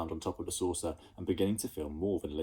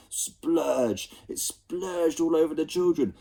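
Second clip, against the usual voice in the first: each splurge higher and louder; building excitement; 6 semitones higher, 4 dB louder, slower than usual. A narrator's reading. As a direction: build the mess up and up.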